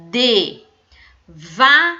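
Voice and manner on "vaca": slow, loud demo word. Shown as a demonstration. Teacher to pupils, showing the syllable va: loud drawn-out word. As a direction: say the word out loud for the class, loud and stretched. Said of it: "começam com a mesma sílaba".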